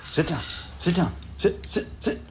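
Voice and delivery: soft, whispered commands to a dog; hushing a pet. Real, not acted. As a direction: settle the puppy down quietly. Calming the new puppy.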